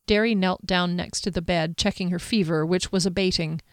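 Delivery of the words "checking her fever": plain reading voice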